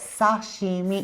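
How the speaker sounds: slow "Sashimi" opening on a clear SAH; correct pronunciation. Shown as a demonstration. Showing co-host how to say it, "Sashimi" as slow sah-SHEE-mee. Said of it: "How do you say it?".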